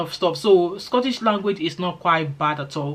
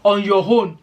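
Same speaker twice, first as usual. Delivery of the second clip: a louder, lightly acted street exclamation; slang exclamation; 3 semitones higher, 6 dB louder than usual. Explaining Nigerian street slang to newcomers, a loud playful exclamation. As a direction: toss out the slang like a quick aside. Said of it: "the way we use all this ijase".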